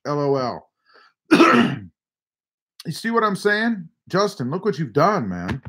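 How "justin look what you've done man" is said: ordinary delivery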